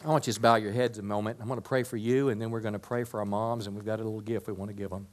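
usual speaking voice

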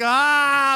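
sharp high loud cry; yelling. Retold or imitated. Under attack by parent birds, high loud cry. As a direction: shriek it out at the birds. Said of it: "I'm, like, yelling".

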